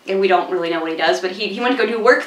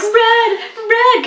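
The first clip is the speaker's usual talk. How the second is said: calling the safe word; 13 semitones higher than usual. Retold or imitated. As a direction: call it out like a frantic plea to stop.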